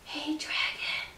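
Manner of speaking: slow, very soft whisper. A narrator's reading. Princess whispering to the sleeping dragon. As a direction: barely breathe the words. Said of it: "Elizabeth whispered very softly".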